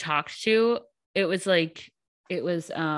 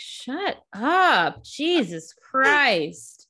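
exasperated; 4 semitones higher, 4 dB louder, slower than usual. Retold or imitated. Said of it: "And it's like".

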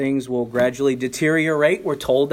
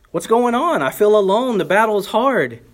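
crying out; 6 semitones higher, 5 dB louder than usual. Pretend. Voicing discouraged believer crying out.

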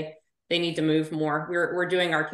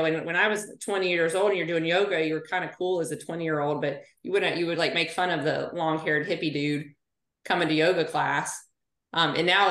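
normal talking voice

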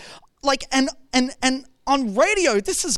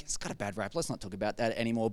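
much lower, much softer, fast aside; dismissive mutter; 13 semitones lower, 11 dB quieter, much faster than usual. Retold or imitated. Pastor voicing churchgoers avoiding the word repent, quiet. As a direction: brush it off under your breath. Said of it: "So we're like".

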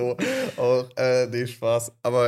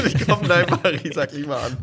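laughing; 4 semitones higher, 5 dB louder than usual. Real, not acted. Jokes about own slip.

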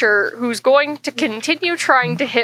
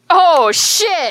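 surprised; 6 semitones higher, 3 dB louder than usual. Real, not acted. Reacting to a natural twenty.

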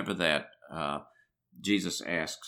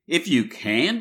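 higher and louder, throwing the words back; sharp retort; 6 semitones higher, 6 dB louder, slower than usual. A narrator's reading. Jesus answering the doubting father, sharply retorting. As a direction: snap back If you can. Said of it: "retorted Jesus".

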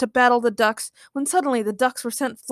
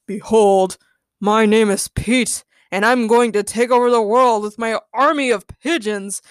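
louder grandiose proclamation; hammy, booming declaration; dramatic villain; 5 dB louder than usual. A narrator's reading. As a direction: announce yourself like a pompous supervillain. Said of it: "in a dramatic fashion".

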